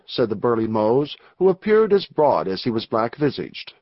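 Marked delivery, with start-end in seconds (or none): none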